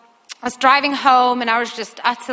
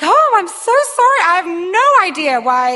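high, loud, gushing apology; flustered apology; 8 semitones higher, 6 dB louder than usual. Retold or imitated. Mother apologizing to stranger her daughter grabbed, flustered. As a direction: apologize in re-enacted astonishment, pitch soaring.

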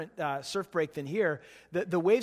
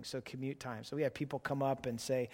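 ordinary talk

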